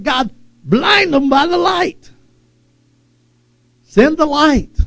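high, impassioned plea to God; urgent prayer. Acted. Preacher praying for unsaved loved ones, urgent prayer. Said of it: "we need to start praying".

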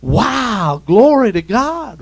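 loud, high exclamation of wonder; big drawn-out mock-amazed shout; amazed praise. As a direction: imitate a crowd bursting into praise. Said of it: "You'd think the people would go".

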